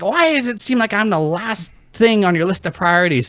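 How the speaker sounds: high mock complaint; aggrieved mom. Mom accusing her child over priorities, high aggrieved imitation.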